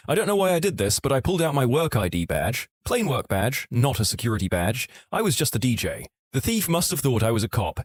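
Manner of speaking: usual speaking voice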